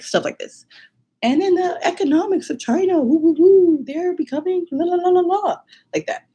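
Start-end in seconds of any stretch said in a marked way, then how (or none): 1.2-5.6 imitating her nervous self. To the contest audience, a nervous high-pitched learner's speech. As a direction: squeak it out like a shy first-year student. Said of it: "two minutes of me being like".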